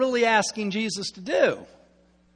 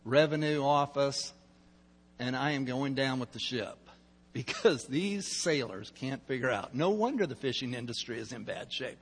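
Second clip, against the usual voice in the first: inner grumble; 6 semitones lower, 7 dB quieter than usual. Retold or imitated. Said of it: "This guy is thinking".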